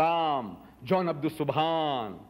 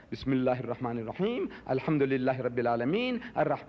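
Quranic recitation; 5 semitones lower than usual. Shown as a demonstration. Recites the opening chapter in Arabic.

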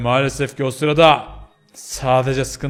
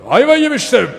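loud, high cry of doom; sudden dramatic wail; panicked outburst; 10 semitones higher, 7 dB louder than usual. Acted. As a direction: exclaim it in mock despair. Teller repeats his doom in panic.

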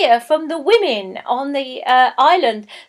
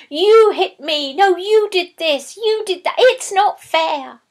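put-on high, loud childish bickering; two kids fighting, higher and louder; squabbling children; 7 semitones higher, 6 dB louder than usual. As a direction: whine like quarrelling siblings. Children arguing.

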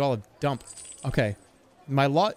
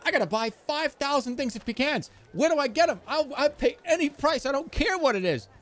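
frantic customer; 12 semitones higher than usual. Retold or imitated. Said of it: "I'm like".

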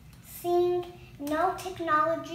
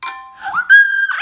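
huge gasp of dismay; loud shocked gasp; 21 dB louder than usual. Real, not acted. Lands on unwanted spin.

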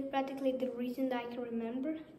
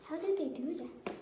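normal talking voice

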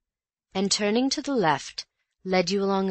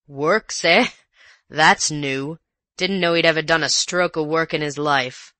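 louder gruff sneer; mock the master's work; sneering colonial; 6 dB louder than usual. Rough visitor sneering at his brother, loud and gruff.

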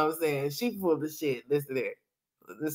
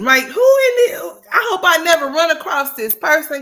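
exasperated shout; 6 semitones higher, 7 dB louder than usual. Real, not acted. Outraged at the vlogger.

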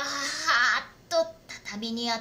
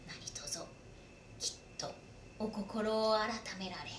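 hushed warning; 6 dB quieter than usual. Acted. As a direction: warn him softly but firmly. Retainer lowers voice to warn.

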